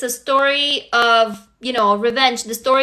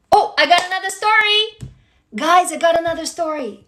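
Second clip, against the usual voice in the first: higher, happily surprised announcement; excited; 4 semitones higher than usual. Real, not acted. Streamer telling the group a story arrived, excited. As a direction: announce it with sudden delight.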